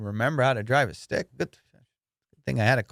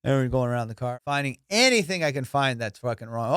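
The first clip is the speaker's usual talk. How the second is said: usual speaking voice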